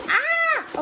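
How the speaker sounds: short high squeal; excited squeal. Real, not acted. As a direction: let out a quick high shriek. Impatient to see the canvas.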